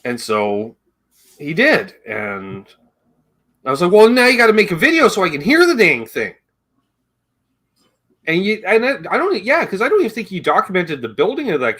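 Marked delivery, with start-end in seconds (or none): 3.9-6.3 excited demand. Retold or imitated. Excited demand in retold quote.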